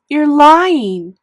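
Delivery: high, very loud angry scream. A narrator's reading. Boy screaming at his sister, furious. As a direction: scream the accusation. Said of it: "I screamed at Via".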